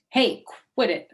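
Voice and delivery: loud, high yell. Acted. Junie yells at May to stop swatting. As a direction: snap it at her. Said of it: "I yelled".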